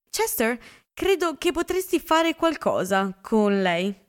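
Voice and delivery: burst out. A narrator's reading.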